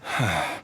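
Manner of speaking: heavy sigh. Acted. Weary hero sighs.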